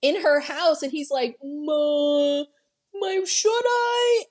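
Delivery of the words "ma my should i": much higher, slow, hesitant dopey voice; mock the idiot cop who can't decide; high, dithering voice of a useless cop; clueless cop. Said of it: "This fucking idiot".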